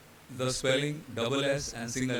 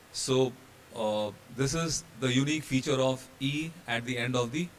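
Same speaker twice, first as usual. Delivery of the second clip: ordinary talk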